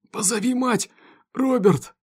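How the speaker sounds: high, strained cry for help; hoarse plea. A narrator's reading. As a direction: force it out through pain. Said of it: "прохрипел Беннет". (хрипло, с натугой)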